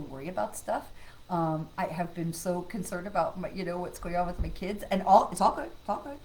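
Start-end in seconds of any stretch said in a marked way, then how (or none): none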